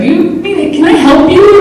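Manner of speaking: high, slow helpful clerk voice; store clerk. Retold or imitated. Home Depot worker offers help, high slow clerk voice. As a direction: offer help slowly, a bit puzzled. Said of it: "this guy was like".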